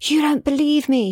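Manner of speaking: high, screamed accusation; screaming. A narrator's reading. Daughter screaming at her mum in the car. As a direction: scream it at your mother. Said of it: "she screams".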